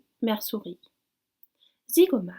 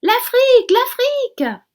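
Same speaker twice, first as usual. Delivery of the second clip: high, loud joyful cry; shouting with joy; 12 dB louder than usual. A narrator's reading. The blackbird crying out to wake his sleeping passengers. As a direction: crow it out at the sight of land. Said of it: "par les cris de Zigomar".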